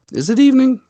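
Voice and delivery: rising, surprised question. Real, not acted. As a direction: sound genuinely surprised. Surprised at greeting time.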